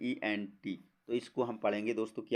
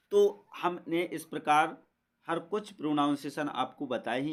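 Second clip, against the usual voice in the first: normal talking voice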